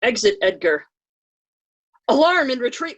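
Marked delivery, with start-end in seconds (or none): none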